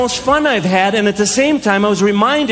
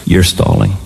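lightly voiced parent, very low; low, unmoved parental accusation; stern parent. Parent catches child stalling at bedtime, stern.

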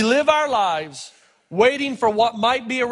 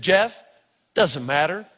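grandma's last word; 9 semitones lower, slower than usual. Retold or imitated. Grandma dumps board, gets last word.

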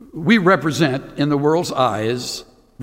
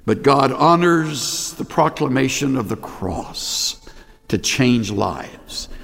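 ordinary talk

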